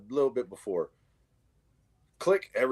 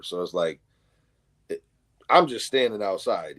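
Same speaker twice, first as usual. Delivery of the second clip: usual speaking voice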